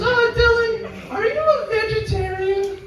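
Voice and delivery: put-on squeaky, very high little boy asking; kid's voice. Host family's son asks guest about dinner, high kid voice. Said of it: "that's how he talks".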